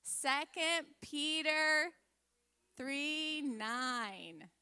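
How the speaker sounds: slow, measured scripture recitation; group recitation. Real, not acted. Teacher leading children in a verse, slow, deliberate recitation. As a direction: stretch each word so others can follow. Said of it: "let's say it all together".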